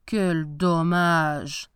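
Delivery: slow, drawn-out lament; rueful and dragging; disappointed. A narrator's reading. Professor's disappointment as no fish bites.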